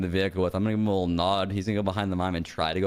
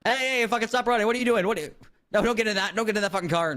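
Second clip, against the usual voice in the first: yelling at suspect; 10 semitones higher than usual. Acted. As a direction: bark at him to stop running. Cop yells at fleeing mime.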